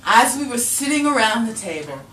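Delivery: ordinary talk, nothing marked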